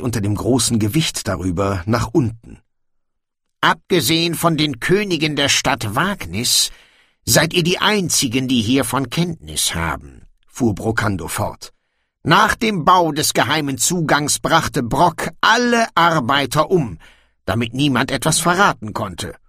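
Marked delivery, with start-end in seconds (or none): 3.6-10.3 higher-pitched dwarf king voice; storybook dwarf king addressing his guests. Acted. 12.2-19.4 higher-pitched dwarf king voice; storybook dwarf king addressing his guests. Acted.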